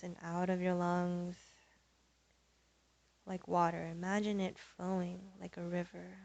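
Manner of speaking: soft, unhurried yoga voice with long pauses; guided meditation. Real, not acted. Leads yoga breathing meditation.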